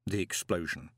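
ordinary delivery, nothing marked

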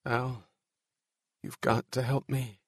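soft private plea; talking to himself. A narrator's reading. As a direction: plead quietly under your voice. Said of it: "he said to himself".

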